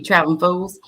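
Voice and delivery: normal talking voice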